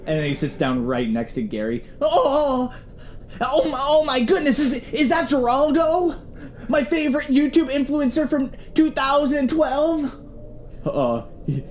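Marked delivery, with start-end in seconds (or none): 2.0-10.1 high, excited gushing; starstruck fan. Acted. Gary gushes over meeting Geraldo, high and excited. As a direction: gasp over a celebrity.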